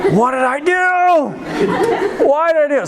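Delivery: very high, drawn-out wail; hammed-up cry of regret; mock anguished wail. Kid walking home laments his wasted year, mock wailing.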